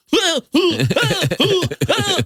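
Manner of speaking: high silly yelps mocking dancers; string of high mock hoots; mocking whoops. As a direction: mimic their whooping, high and goofy. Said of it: "she's mocking them".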